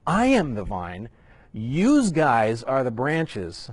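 put-on slow, drawn-out Jersey accent, yous guys; a joking New Jersey guy reading Jesus. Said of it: "my best Jersey imitation".

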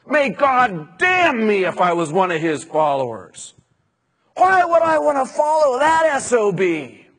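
hammed-up swearing denial; retold cursing oath. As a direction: spit out the oath like a cornered man. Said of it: "he began to curse and swear".

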